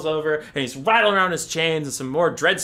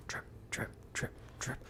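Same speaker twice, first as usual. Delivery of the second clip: dripping sound; 21 dB quieter than usual. Retold or imitated. Imitated dripping water.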